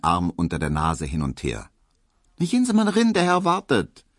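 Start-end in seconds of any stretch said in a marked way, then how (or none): 2.4-4.1 high, a little louder, brushing him off; lightly voiced maid. A narrator's reading. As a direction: shoo him in. Hedwig dismissive to Emil.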